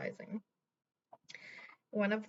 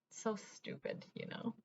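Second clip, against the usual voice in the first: soft whisper, much softer; whispered aside; 10 dB quieter than usual. Real, not acted. Telling viewers the uncle's act was dumb, whispered. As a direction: breathe it as a private aside.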